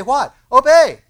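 high, loud preacher's shout; emphatic exclamation. Acted. Preacher answering the congregation's imagined question, emphatic shout. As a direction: fire the question, then shout the command.

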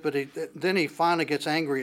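ordinary talk, nothing marked

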